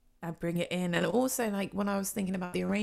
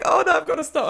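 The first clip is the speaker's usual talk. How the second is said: retold alarm; 8 semitones higher, 11 dB louder, faster than usual. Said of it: "I was like".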